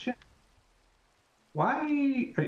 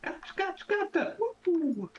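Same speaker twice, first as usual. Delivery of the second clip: frog voice; slower than usual. Pretend. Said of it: "I call it the frog voice".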